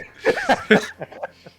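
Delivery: loud, high-pitched laugh; high burst of laughter trailing away. Real, not acted. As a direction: crack up at your own story. Cracks up at own story.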